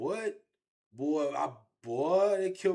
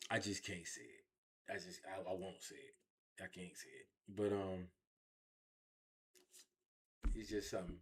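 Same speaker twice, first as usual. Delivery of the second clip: real grief, words barely getting out; fading into quiet, tearful breaths; choking up; 8 semitones lower, 13 dB quieter than usual. Real, not acted. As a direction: voice sinking to a near whisper, holding back tears. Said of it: "Take your time, man".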